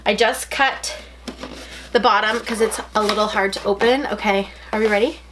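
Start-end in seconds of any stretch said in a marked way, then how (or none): none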